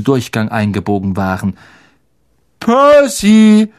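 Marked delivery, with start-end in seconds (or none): 2.6-3.7 "Percy" high, loud and stretched; automatic correction. A narrator's reading. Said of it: "korrigierte ihn Ron wie von selbst".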